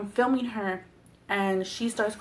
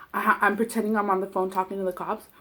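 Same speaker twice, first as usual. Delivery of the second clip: ordinary delivery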